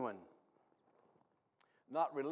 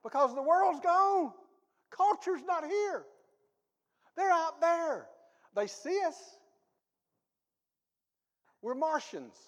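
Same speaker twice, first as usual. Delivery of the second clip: fiery preaching; 11 semitones higher, 9 dB louder, slower than usual. Real, not acted. Preaching at full force.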